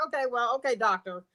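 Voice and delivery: retold resignation. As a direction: drop it low and quiet, giving in to the child. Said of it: "And I'm like".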